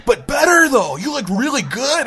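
high, enthusiastic surfer-bro praise; imitated loud reassurance; complimenting surfer. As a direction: gush like an impressed dude. Surfer compliments supposed friend.

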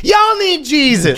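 high, slow preacher call. Pretend. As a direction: preach it at them. Mock preacher line.

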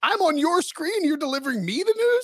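high-pitched indignant ranting; fed up, yelling at a gadget; exasperated rant. Retold or imitated. Ranting at the app.

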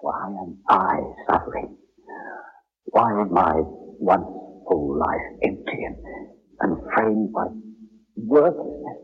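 low, brooding soliloquy; anguished brooding. Acted. Brooding alone, questioning his suffering.

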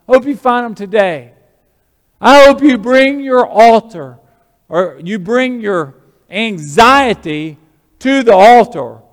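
high, loud exhortation to a congregation; full-force preaching. Real, not acted. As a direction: urge them on, hitting the key words hard.

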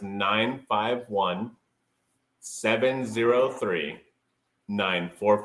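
slow, carefully spelled-out digits; slowly. Shown as a demonstration. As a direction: read each number out slowly and clearly.

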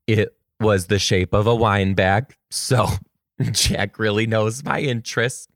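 normal talking voice

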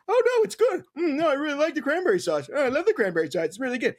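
high, put-on voice pretending to enjoy it; mock-sweet fake praise of the sauce; fake cranberry fan. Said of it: "They're eating it for effect".

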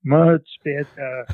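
put-on slow approval in a Central European accent; grandmother's Central European accent. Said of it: "imitate my grandmother, uh, my grandmother's, uh, English".

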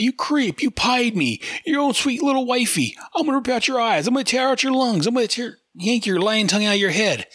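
high, furious shrieking wife. Acted. Wife attacking her husband after he pied her, high furious shriek. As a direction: hurl threats at him in a high cartoon voice. Angry wife voiced in comic.

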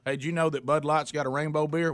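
usual speaking voice

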